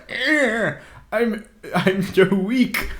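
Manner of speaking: high, slow, mock frail old man; feeble old Palpatine. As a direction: whine like a fake dying emperor. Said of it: "Palpatine's like".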